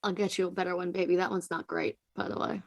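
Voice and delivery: low, very soft murmur; hushed aside. Acted. Companion quietly consoling someone losing a knife, hushed aside. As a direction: say it softly, just for one listener.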